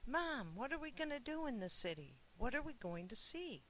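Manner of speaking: put-on high, eager kid's questions; excited child. A narrator's reading. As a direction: bubble over with curiosity like a young boy. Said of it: "He started to quiz his mother excitedly".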